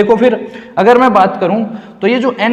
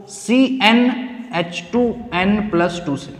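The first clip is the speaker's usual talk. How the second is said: softer, slow, spaced-out formula; slow dictation; 6 dB quieter, much slower than usual. Real, not acted. Teacher introducing formula to students, slow dictation. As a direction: spell out CNH टू N plus टू piece by piece, slowly.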